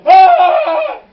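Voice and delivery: high, loud mock scream of frightened kids; imitating the boys screaming in fright; kids' scream. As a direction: shriek like a scared little kid. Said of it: "and they're like".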